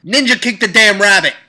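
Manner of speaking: loud, high burst of rampage; shouted threat. Acted. Imagining a chain-belt rampage, shouting loud and high. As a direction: bark it out mid-rampage.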